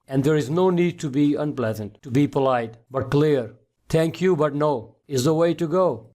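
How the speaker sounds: ordinary delivery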